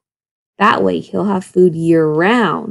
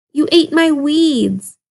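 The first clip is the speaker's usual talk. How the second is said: surprised; 7 semitones higher, slower than usual. A narrator's reading. Wolf surprised at goat.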